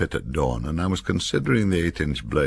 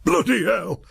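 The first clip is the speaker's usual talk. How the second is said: much higher, louder yelp of shock; startled exclamation; 5 dB louder than usual. A narrator's reading. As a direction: cry out as the knife comes up. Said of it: "the fallen figure exclaimed".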